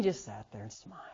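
whispered. Real, not acted. Preacher recalling his pastor's silence, whispered. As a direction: drop to a whisper.

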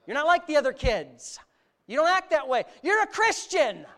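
loud, high, hammering sermon shout; fiery preacher driving the point home; full-force preaching. Acted. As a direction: preach it hard at the congregation, some lines slowed.

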